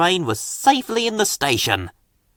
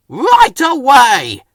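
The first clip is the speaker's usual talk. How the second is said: louder, slow eager answer; Harold replying; 10 semitones higher, 8 dB louder, much slower than usual. A narrator's reading. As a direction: answer keenly, stretched out. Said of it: "Harold replied".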